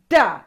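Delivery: "Duh" with the tongue behind the teeth, pushed out hard; D sound demo. Shown as a demonstration. Teaching kids the D sound, "Duh" pushed hard. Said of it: "Push that sound out real hard".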